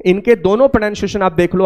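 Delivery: ordinary talk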